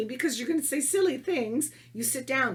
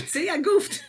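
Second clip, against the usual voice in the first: loud, higher, laughing admission of a slip; 10 semitones higher, 6 dB louder, slower than usual. Real, not acted. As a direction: burst out laughing at your own goof.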